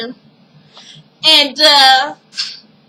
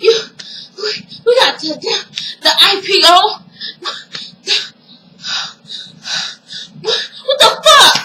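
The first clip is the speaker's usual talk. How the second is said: retold meltdown; 6 semitones higher than usual. Andre losing it over the IPO, stammering outburst.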